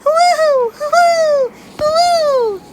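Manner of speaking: high, loud wind whoosh; hooting storm wind; howling wind. A narrator's reading. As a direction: howl like the blizzard. Snowstorm wind imitated.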